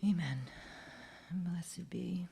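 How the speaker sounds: soft, hushed prayerful voice; solemn prayer. Acted. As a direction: lead a quiet moment of silence. Moment of silence, prayer.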